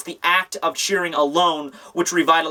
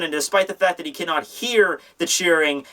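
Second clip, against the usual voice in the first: ordinary talk, nothing marked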